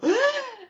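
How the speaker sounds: sudden high sharp gasp of fright; retold gasp of alarm. Skier catching speed, sharp frightened gasp. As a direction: gasp as if sliding down the slope. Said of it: "I go".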